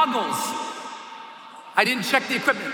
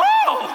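high-pitched yelp; acted high "oh" of shock; startled cry; 3 dB louder than usual. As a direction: cry out as if just smacked. Struck twice while falling.

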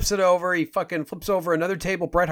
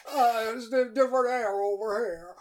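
high, soft, thin-air character voice; different-sounding Jimmy; 7 semitones higher, 5 dB quieter than usual. Pretend. Fake Jimmy blames the air over here, high soft voice. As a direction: excuse yourself in a high little voice. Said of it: "You sound a little different that time".

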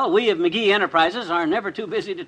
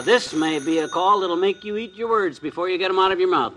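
usual speaking voice

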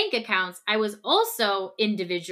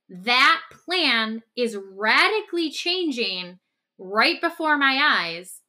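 louder, slow, each word pressed; slow, higher, emphatic protest; firm insistence; 3 semitones higher, 4 dB louder, slower than usual. Acted. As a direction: insist slowly on the agreed plan. Role-play partner insists on plan.

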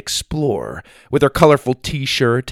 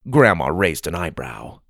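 plain reading voice

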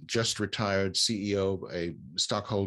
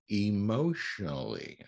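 emotionally drawn out, each syllable weighted; stress on emotionally; slower than usual. Real, not acted. Speaker underlines a key word for the listener, emotionally stressed and stretched. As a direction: lean slowly on the word emotionally. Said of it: "notice how I emphasize that word".

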